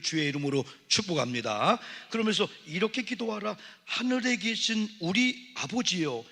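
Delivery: preaching at full force. Acted. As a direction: bless them with all your voice.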